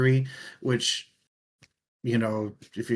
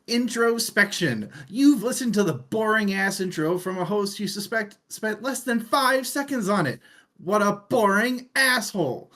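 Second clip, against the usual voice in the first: game system announcer; 12 semitones higher, 4 dB louder than usual. Acted. Host mocking his own intro as the book's game system announcer, booming and higher. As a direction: hype it up like a sarcastic game system AI.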